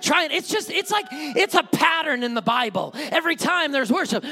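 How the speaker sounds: usual speaking voice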